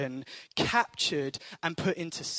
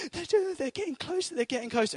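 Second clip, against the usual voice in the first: scared daughter; 11 semitones higher, faster than usual. Retold or imitated. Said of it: "she's going".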